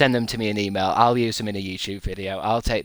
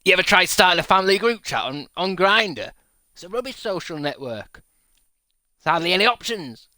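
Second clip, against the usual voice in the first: mock outrage; 9 semitones higher, 5 dB louder than usual. Joking Grindr complaint, raised voice.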